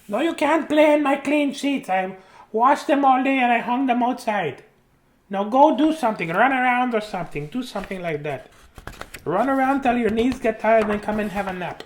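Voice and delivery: high-pitched put-on grandma voice; scolding grandma. Grandma shooing child from her clean sheets, scolding. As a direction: fuss at the kid in a high grandma voice.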